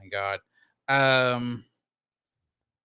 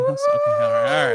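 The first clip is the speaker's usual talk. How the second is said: high mock exasperated shout; 6 dB louder, faster than usual.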